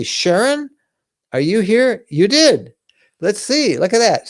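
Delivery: high, bright, excited call to a viewer; delighted call-out. Real, not acted. Host spotting the first buyer, excited call-out. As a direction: call her name up high, thrilled. Thrilled at first book purchase.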